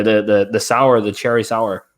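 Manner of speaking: ordinary talk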